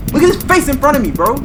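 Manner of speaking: high, loud and fast in fright; panicked shout at the screen; freaked out. Real, not acted. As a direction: yelp it out, spooked by the face. Scared by jump scare in game.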